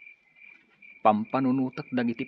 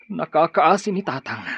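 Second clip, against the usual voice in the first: anguished outcry; 6 semitones higher, 8 dB louder than usual. Acted. Edgar grieving over his father.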